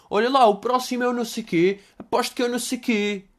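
lightly put-on chatter of someone guessing aloud; mimicking viewers. Mimicking audience guessing next question.

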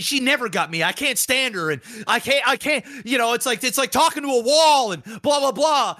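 high-pitched mock exasperation; exasperated venting. Boyfriend complaining to another girl about his partner, mock exasperated. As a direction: whine it out like a fed-up boyfriend.

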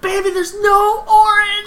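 very high, drawn-out mock wail; hammed-up exasperated mom. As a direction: imitate a mother pleading with her upset kid, high and slow. Mom's exasperated answer to upset child.

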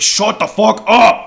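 mock turkey yell. Turkey stunned by her scream yells at her, hammed-up. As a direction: bark it at the screaming girl. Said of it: "He's like".

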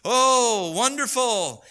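high, sneering mock taunt; a crowd of mockers taunting Jesus. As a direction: jeer at him from below the cross. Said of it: "making fun of him and saying".

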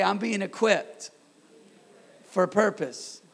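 loud, slow phrases for a crowd to echo; call-and-repeat declaration. Real, not acted. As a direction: lead the congregation, pausing after each phrase. Leads congregation in repeated declaration.

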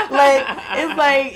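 loud burst of laughter; real laughter at the memory; laughing. Real, not acted. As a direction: laugh through the words. Laughing at the news.

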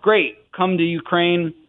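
slow, upbeat quoted invitation; imitated eager offer. School recruiters inviting a young teacher, imitated breezy enthusiasm. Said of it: "They're like".

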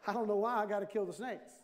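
ordinary talk

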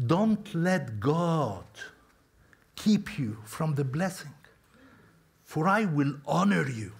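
a hint of a slow, persuasive king; coaxing king. King coaxing the prophet back, slow and persuasive. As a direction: draw the words out like a flattering ruler. King tempting Balaam with honor.

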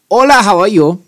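Spanish with a mock German accent. Shown as a demonstration. Podcaster demos a German-Mexican dog's accent, German-accented Spanish. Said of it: "speaking in Spanish with a German accent".

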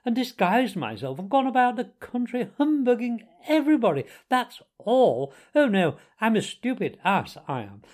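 high, loud, swaggering storytelling; conceited braggart, pitch leaping high on the boasts; boastful Toad. A narrator's reading. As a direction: show off with mock modesty. Toad boasts of his adventures.

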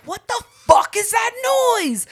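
high, loud alarmed yell; startled shout. Retold or imitated. Startled by noise.

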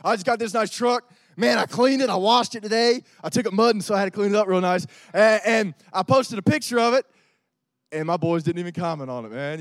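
loud, pumped-up bro voice; loud bro bragging, a bit hammed-up; guy bragging about his truck. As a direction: brag like a dude who wants comments on his truck. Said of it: "fellas, we do the same thing".

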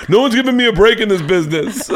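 high, loud mock drunken complaint; hammed-up high drunk griping; drunk dad rant. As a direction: wail it like a self-pitying drunk. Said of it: "That's where Dad goes".

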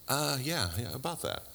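mock-sheepish, caught out and backpedalling; soft, awkward mumbled excuse, played for a laugh. Grumbler caught out when Jesus shows up, sheepish.

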